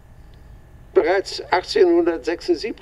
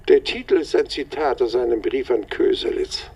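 ordinary delivery

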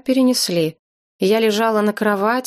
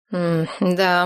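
ordinary delivery, nothing marked

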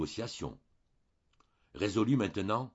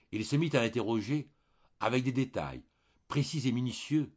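ordinary delivery

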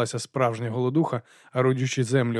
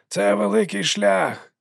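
put-on slow, higher old sweeper's shout; shouting back; 8 semitones higher, slower than usual. A narrator's reading. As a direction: call back slowly over the wind. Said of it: "крикнув у відповідь підметальник". (старий підмітальник гукає у відповідь)

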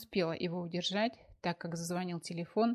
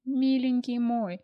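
tearful fuss; 5 semitones higher, 6 dB louder than usual. Acted. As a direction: wail over him in distress. Sonechka comforting beaten lover.

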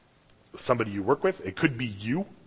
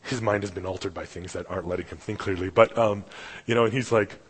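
ordinary talk

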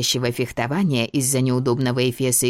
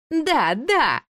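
высоко, радостно, восхищённо; обрадоваться найденной героине; восторженно; 14 semitones higher than usual. Acted. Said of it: "довольно захлопала". (восторженно)

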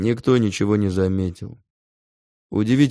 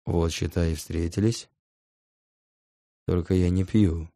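ordinary delivery

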